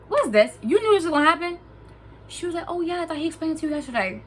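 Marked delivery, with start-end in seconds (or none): 0.1-1.6 raised, higher accusing questions; loud, indignant retold demand; indignant confrontation. 2.7-4.2 high, quick imitation of her excuse; fast, higher-pitched mocking voice; offhand girl. Mimics the girl's offhand excuse.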